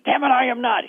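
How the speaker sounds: high, loud angry denial; cursing denial. Retold or imitated. Peter denying Jesus, high and loud. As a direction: snap it out like a cornered man. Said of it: "He even curses and says".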